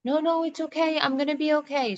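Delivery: retelling telling son she's fine; high strained reassurance. Retold or imitated. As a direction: soothe the kid through the pain. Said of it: "I'm like".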